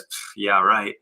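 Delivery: sarcastic scoff. Retold or imitated. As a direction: dismiss it like a doubting inner voice. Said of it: "to which your brain says".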